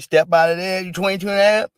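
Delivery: mock judge calling him out; mock judge order. Acting judge ordering Chauvin out.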